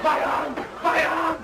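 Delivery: high, panicked cry of pain; pained shout. Acted. Patient overreacting to an alcohol swab, pained shout. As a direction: wail as if badly wounded.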